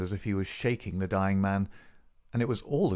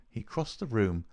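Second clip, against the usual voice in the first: usual speaking voice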